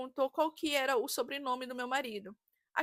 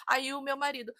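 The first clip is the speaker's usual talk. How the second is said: usual speaking voice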